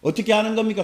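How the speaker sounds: ordinary talk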